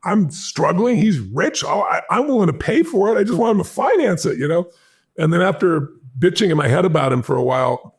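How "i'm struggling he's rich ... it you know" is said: 1 inner rant. Retold or imitated. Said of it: "And I was pissed off".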